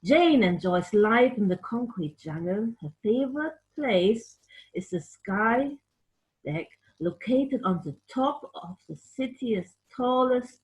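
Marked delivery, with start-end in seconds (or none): none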